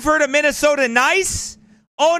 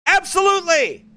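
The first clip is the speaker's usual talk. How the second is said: emphatic agreement; 6 semitones higher than usual. Real, not acted. Fervent agreement with quote.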